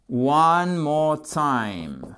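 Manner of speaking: long, lingering vowels; slow teaching model. Shown as a demonstration. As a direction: stretch every word for the learners. Teacher models phrase slowly.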